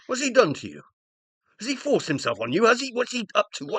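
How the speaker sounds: alarmed outburst. Acted. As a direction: fire the questions off in alarm. Husband alarmed for wife.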